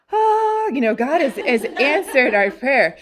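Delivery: high, loud, delighted exclamation; acting out a joyful sigh that prayer is answered; elated relief. Acts out husband's answered-prayer joy.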